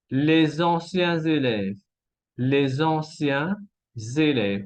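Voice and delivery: les anciens élèves with both s as z; two liaisons. Shown as a demonstration. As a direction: slowly link "les anciens élèves" as lé-zanciens-zélèves. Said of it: "Hay dos conexiones".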